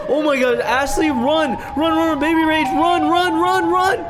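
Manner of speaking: loud, high-pitched frantic shouts; panicked yelling. Acted. Dad spots Siren Head and urges family to run, panicked. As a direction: yell for everyone to flee in terror.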